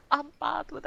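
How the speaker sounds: high, voiced comic admission; high-pitched confession. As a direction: own up aloud in a high voice. Repeats the confession aloud.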